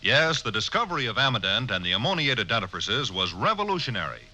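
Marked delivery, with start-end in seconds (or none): none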